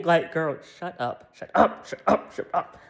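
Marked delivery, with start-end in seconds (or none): none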